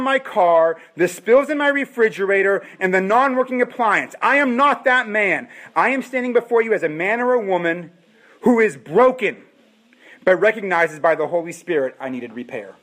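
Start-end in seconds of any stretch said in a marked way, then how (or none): none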